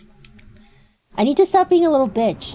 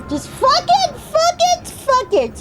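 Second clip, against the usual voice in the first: exasperated shout; slower than usual. Real, not acted.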